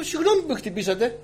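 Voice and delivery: high, mock-polite apology; sweetly sarcastic sorry; ironic apology. Preacher needles the woman who shoved him, ironically. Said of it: "Αλλά εγώ το είπα ειρωνικά".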